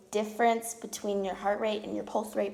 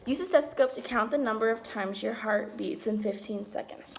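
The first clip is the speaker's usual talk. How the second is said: reading aloud; 4 dB louder than usual. Acted. Reads lab instructions aloud.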